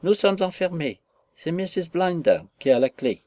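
put-on high, loud small boy calling through a door; child's voice. Locked-in boy calls to visitors through the door, high child voice. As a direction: call out like a locked-in little boy. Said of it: "Une voix enfantine me répondit".

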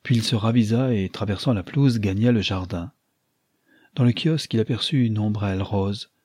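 usual speaking voice

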